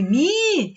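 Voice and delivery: MI held long and slow; elongated syllable. Shown as a demonstration. Teacher pointing out the stress, MI drawn long. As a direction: stretch out mi as the strong syllable. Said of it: "Ele se alonga".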